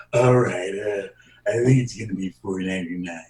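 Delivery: a tipsy cashier mumbling the total; husky drunk cashier. Shown as a demonstration. Drunk cashier slurs the price to a customer, low and husky. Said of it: "the husky voice cashier who appears to be drunk".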